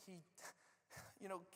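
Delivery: normal talking voice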